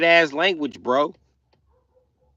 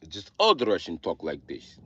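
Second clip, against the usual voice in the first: slow, heavy mock Russian accent; 2 semitones lower than usual. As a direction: talk like an old-school Russian with a thick accent. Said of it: "they think all Russians talk like this".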